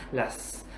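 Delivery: "s" đọc như tiếng xì gió, không rung dây thanh; thì thầm "s" thành tiếng xì nhẹ. Shown as a demonstration. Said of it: "đọc cái thằng S đấy là". (thầy minh họa "s" vô thanh bằng tiếng xì)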